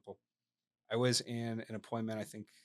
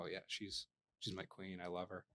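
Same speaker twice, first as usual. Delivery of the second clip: tender husband; 3 semitones lower, 10 dB quieter than usual. Retold or imitated.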